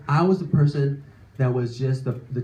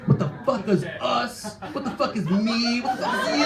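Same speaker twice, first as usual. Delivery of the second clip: rising, higher disbelieving rant; exasperated outburst; 6 semitones higher than usual. Retold or imitated. Comic reliving his angry confusion with girlfriend, half-laughing rant. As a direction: climbs higher on each question.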